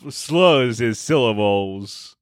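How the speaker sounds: each syllable stretched slow; slow, drawn-out demo. Shown as a demonstration. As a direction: drag the syllables out. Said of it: "Homestar Runner".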